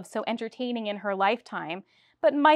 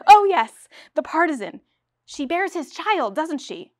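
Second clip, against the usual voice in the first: eager director; 6 semitones higher, 6 dB louder than usual. Acted.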